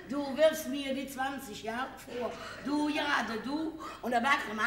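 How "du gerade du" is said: ordinary delivery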